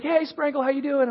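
high-pitched mock student greeting; imitating friendly students. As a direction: greet a teacher like a chummy student. Students greet their old teacher.

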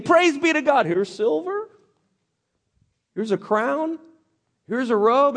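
high, slow, wide-eyed praise voice; a thief hamming up his praise; gleeful Achan. Retold or imitated. Achan gushing praise to the people, high and gleeful.

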